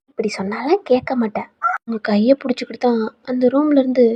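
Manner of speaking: ordinary delivery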